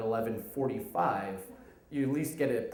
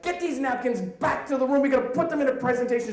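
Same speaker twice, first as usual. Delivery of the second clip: high, raised-voice command; urgent order; 11 semitones higher, 5 dB louder than usual. Retold or imitated. Manager sending drunken colleague back to the room, urgent loud order.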